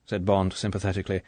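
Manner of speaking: usual speaking voice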